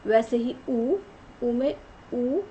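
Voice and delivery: ordinary talk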